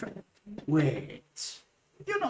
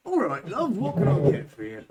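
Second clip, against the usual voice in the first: put-on Goliath barkeep in a Devon accent; 4 semitones higher, 5 dB louder than usual. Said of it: "the Goliath colloquialism is Devon".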